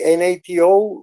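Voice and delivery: N A T O said letter by letter; spelled out. Shown as a demonstration. Asking how English says it, N A T O spelled out. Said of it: "how do you say nato in English".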